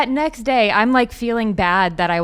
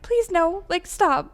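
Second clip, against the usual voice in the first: high-pitched imitated begging; mock pleading; 9 semitones higher, slower than usual. Pond owner begging the heron to stop, mock pleading. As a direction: beg helplessly, pitched way up.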